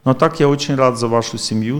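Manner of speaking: ordinary delivery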